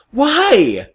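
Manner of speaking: high, loud pleading why; outraged, begging for a reason; exasperated. Real, not acted. Exasperated outburst about Doom.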